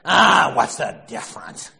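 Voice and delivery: loud dismissive bark; gruff shout. Retold or imitated. Archie shouting at Edith over his shoes. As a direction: brush her off with a shout.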